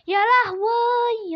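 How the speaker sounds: slow, high, loud drawn-out cry of dread; drawn-out wail. Real, not acted. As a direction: stretch it into a panicked moan. Panic as he may be picked. (عويل بطيء عالٍ من الرعب)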